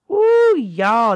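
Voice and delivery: emotional exclaim. Real, not acted. Moved by the word.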